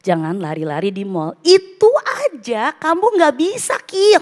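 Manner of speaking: mother retelling how she scolded her son; retold angry scolding. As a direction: scold the child in exasperation. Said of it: "Aku marah banget".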